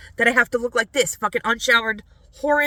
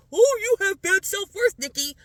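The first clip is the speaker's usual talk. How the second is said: mocking quote; 9 semitones higher, 4 dB louder than usual. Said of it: "you project that onto me and say".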